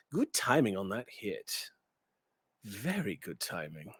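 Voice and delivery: low, hushed, dry praise. Acted. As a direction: murmur it with a sly smirk. Cadmiel's parting remark to Jones.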